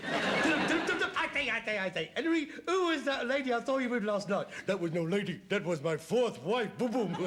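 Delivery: mock music-hall comic patter; music-hall double act. Son mocking dad's seance as a variety turn, music-hall patter. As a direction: play both halves of a corny comedy double act. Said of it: "Are they doing a double act?".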